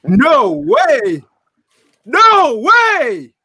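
high, loud cry of disbelief; excited disbelief. Real, not acted. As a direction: shout it, can't believe it. Pick announced for their team.

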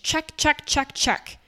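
mock the box-ticking; mocking checklist. Mocking the expected boxes, sing-song. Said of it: "ticking boxes".

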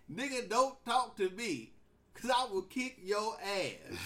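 slow, heavy, every word weighted; stern warning. Real, not acted. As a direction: lay down the law slowly. Warning to kids.

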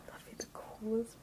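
whispering. Real, not acted. Excited aside to herself.

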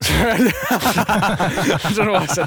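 cracking up at his own slip; laughing. Real, not acted. Friend cracks up at his own slip, laughing through it.

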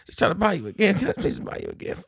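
very high, strained imitation of begging; mock exasperated plea. Man begging to buy her a gift, mock exasperation. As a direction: plead in a hammed-up high voice.

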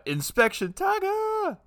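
hyped-up high title call; excited. Pretend. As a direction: announce it like a cartoon show. Hyped mock title announcement.

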